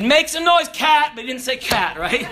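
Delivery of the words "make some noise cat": higher, louder mocking command; mocking order. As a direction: taunt the cat at the tree. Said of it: "He said".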